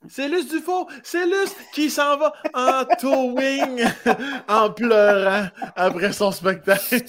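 mock headline, laughing through it; teasing, cracking up. Real, not acted. Mock headline about friend, laughing.

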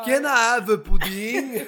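high, loud, slow put-on voice; mock French customer. Mimicking her French customers asking for pudding, high and loud. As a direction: whine it like a fussy customer.

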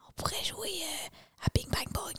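put-on hushed kid's whisper. Kid whispering a game idea to siblings. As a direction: whisper so dad won't hear. Kids whispering after lights out.

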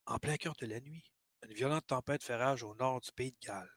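narration basse et feutrée, comme une confidence; voix sensuelle. Acted. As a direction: lis l'histoire d'une voix lente et sensuelle. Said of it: "je vais y aller avec une voix, euh, sensuelle". (voix sensuelle de conteur)

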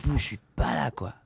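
dazed and breathless from brain freeze. Real, not acted. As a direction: trail off, stunned by a brain freeze. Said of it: "ça me le fait maintenant".

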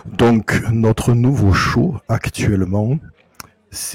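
low, suave announcer voice; seductive radio voice. Acted. Zomblard begins presenting the new show, deep suave voice. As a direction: pitch it deep as the show's host. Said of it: "avec cette voix".

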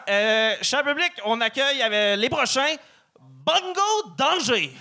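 high, slow stage host introduction; announcer voice. Shown as a demonstration. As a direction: stretch the name out like an MC. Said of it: "Dire ça comme en français, c'est pas comme avec un accent anglais".

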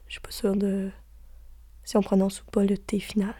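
soft unvoiced whisper, quick. Real, not acted. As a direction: breathe it out as a hushed aside. Whispered aside in a list.

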